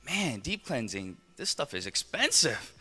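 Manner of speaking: soft, slow aside of disbelief; quietly appalled, drawing the words out; dismayed realization. Retold or imitated. Shopper husband realizing the cost to himself, quietly dismayed. Retells his realization in the store.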